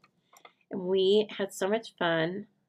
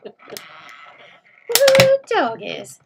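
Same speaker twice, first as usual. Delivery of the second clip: Texas yeehaw, much higher and louder with a laugh; loud Texas whoop; 6 semitones higher, 12 dB louder, much slower than usual. Retold or imitated. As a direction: whoop it like a rowdy Texan, laughing. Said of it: "Do you say that, Corinne?".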